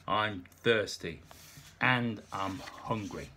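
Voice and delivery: slow, drawn-out tipsy words; lightly played slurred drunk. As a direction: spell it out like a drunk. Drunk Nick complaining.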